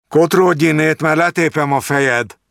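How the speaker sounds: high, loud rough threat; threatening bouncer. A narrator's reading. Bouncer driving the old beggar away, threatening. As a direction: chase the beggar off with a menacing bark. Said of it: "mondta a kidobó".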